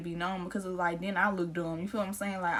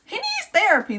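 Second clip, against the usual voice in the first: sarcastic outburst; 10 semitones higher, 7 dB louder than usual. Real, not acted. Mocking the friend's claim.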